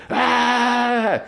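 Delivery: long high-pitched held yell; imitated scream, held back in volume; sustained high "aah" yelled in imitation; mock yell. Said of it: "he yells again".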